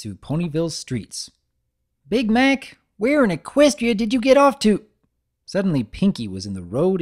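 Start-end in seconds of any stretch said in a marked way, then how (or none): 2.1-4.8 loud, high search call. Acted. Applejack calling for Big Mac, loud. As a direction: shout for her brother down the street.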